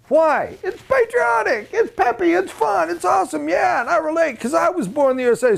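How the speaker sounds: very high, giddy mock enthusiasm; gushing fan. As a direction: gush like a thrilled fan, pitch way up. Voicing an enthusiastic fan of song.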